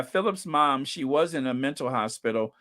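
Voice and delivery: ordinary talk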